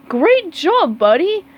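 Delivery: bright high praise for a buddy; cheerful praise. Acted. Sandy praising SpongeBob after his vault, cheerful. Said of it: "said Sandy".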